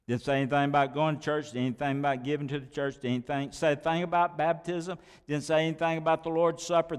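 quick throwaway list of what it isn't; rapid list. Real, not acted. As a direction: rattle them off dismissively.

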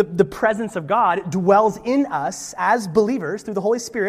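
usual speaking voice

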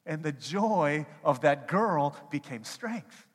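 high, loud pulpit climax; preaching at full force. Acted. Preacher explaining joy to congregation, loud high preaching. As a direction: drive the point home to the congregation.

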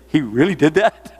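mock astonishment with a laugh; amused disbelief. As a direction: imitate a listener who can't believe it. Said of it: "you're gonna say".

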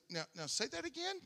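a startled pastor echoing his shock; stunned disbelief. Retold or imitated. Pastor asks Noni on phone to repeat, stunned and high.

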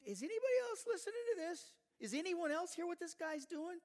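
high, fast, disbelieving cry; David can't believe what he hears; incredulous outburst. Acted. David reacts to Goliath's taunts.

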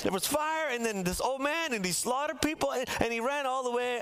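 high, eager rush of words; excited husband. Retold or imitated. Husband telling wife wild story, pitched high and eager. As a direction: pile it on like a husband with a wild tale.

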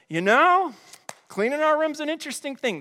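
put-on arguing kid. Kid told to clean room, arguing back. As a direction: play a kid starting a clever objection. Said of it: "And they go".

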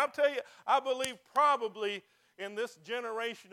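loud, fervent preaching. Acted. Pastor urging the congregation, loud fervent preaching. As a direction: drive it home to the congregation. Preacher building to full force.

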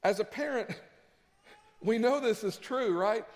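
ordinary talk, nothing marked

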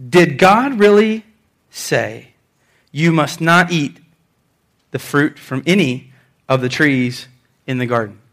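measured serpent question, dropping away at the ends; deliberate, stilted questioning. Acted. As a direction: draw it out formally, pausing between phrases. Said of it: "people that talk like this".

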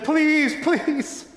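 high, trembling please; a frightened girl begging for mercy; tearful pleading. A narrator's reading. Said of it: "tears and trembling".

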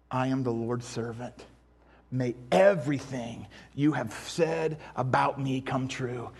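very low, hushed, reverent; solemn surrender. Acted. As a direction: voice Mary's humble submission softly, with weight. Mary's solemn surrender, quoted.

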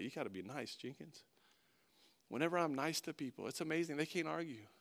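very soft, low voice with long pauses; quiet, low pastoral confiding; hushed, reflective preaching. Real, not acted. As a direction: let the sermon sink to a gentle near-murmur.

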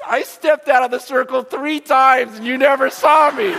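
high, loud, giggly reveal; man in the circle gloating to the gangster; gleeful punchline. Retold or imitated. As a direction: burst out with it, laughing at him. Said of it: "And the guy goes".

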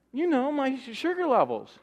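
veel hoger, spottend nagedaan; zeurend volk. Retold or imitated. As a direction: doe een klagend volk met lage bloedsuiker na. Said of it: "That's what they're saying to him".

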